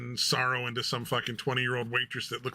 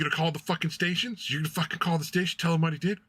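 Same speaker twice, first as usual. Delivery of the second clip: higher, fast and heated, shouting on some lines; mock angry lady; 5 semitones higher, faster than usual. Paranoid lady shouting at a streamer, higher and fast. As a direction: rant at her like a paranoid stranger on the street. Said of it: "'Cause the lady just like".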